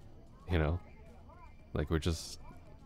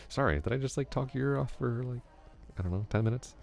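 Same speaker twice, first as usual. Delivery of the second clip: higher, sheepish sudden apology; embarrassed realization; 8 semitones higher than usual. Real, not acted. Vlogger to listener, apologizing sheepishly for talking too long. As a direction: catch yourself mid-ramble, a bit flustered.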